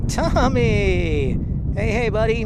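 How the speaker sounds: loud, bright hail across the room; calling out. Real, not acted. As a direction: call the name out with a big shout. Calling out a greeting to viewer.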